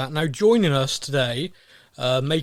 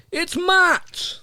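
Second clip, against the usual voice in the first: announcer build-up; 4 dB louder than usual. Acted. Announcing guest.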